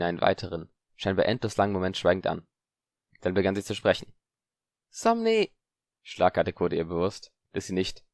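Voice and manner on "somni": half-cast spell. Acted. Spell started then cut.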